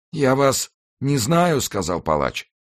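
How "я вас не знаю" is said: slow, high, strained voice; fearful denial. Acted. Executioner faces the stranger, slow high fearful denial. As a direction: deny it in dread, pitched up. Dying man facing his accuser.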